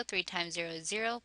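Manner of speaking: ordinary talk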